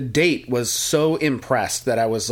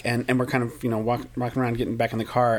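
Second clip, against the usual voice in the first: usual speaking voice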